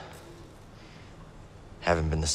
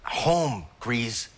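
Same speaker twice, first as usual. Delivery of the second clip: bitter outburst; 7 semitones higher than usual. Acted. Cal rejects settling down, upset.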